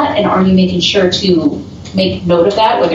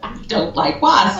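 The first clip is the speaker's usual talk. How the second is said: higher, slow, mock-solemn echo; deadpan; 8 semitones higher, 4 dB quieter, slower than usual. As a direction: repeat it with mock seriousness. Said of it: "dead looks at the camera and says".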